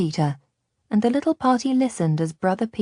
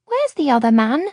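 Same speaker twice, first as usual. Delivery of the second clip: put-on little girl asking; 7 semitones higher, 5 dB louder than usual. A narrator's reading. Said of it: "she asked".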